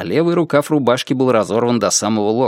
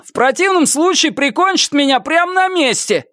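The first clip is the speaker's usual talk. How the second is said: shouting. A narrator's reading. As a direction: yell it out to the street. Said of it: "прокричал он".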